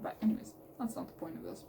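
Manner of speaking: soft unvoiced whisper, trailing off; whispered aside. Real, not acted. Steering herself back to the book, in a whisper. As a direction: whisper to yourself to get back on track.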